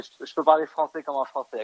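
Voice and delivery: switching into fluent native French. Shown as a demonstration. Chef proving to the host he speaks real French, in native French. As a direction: rattle it off like a born Parisian. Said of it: "I, I could, I could speak".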